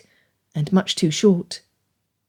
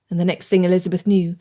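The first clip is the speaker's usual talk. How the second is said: usual speaking voice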